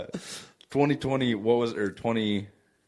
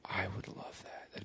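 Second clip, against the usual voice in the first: whispering; 3 semitones lower, 21 dB quieter than usual. Real, not acted. Excited co-host reacts to idea.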